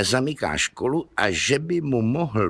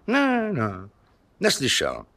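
zapírání; 4 semitones higher than usual. Acted. Teacher denies hearing.

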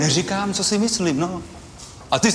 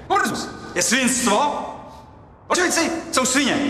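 defiant proclamation; 6 semitones higher than usual. Acted. Proudly declares his own opinion.

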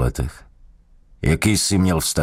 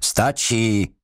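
higher, barked order to stop; sharp command; 6 semitones higher than usual. Acted. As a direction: cut him off hard. Interrogator halts the beating.

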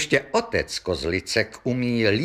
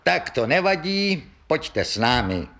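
high-starting, mildly officious dismissal; brusque, officious; 6 semitones higher than usual. Acted. Policeman ordering both brothers along, officious. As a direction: brush it aside like an official. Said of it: "řekl příslušník komisně".

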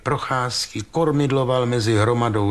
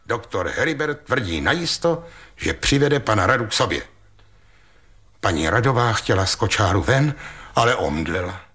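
plain reading voice

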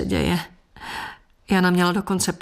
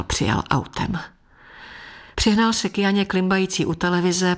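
ordinary delivery, nothing marked

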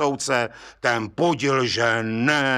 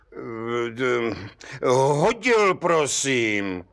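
hesitant, halting answer; the bricklayer stammering before the judge; much slower than usual. Acted. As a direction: stumble over the words nervously. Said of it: "koktal mladý cihlář".

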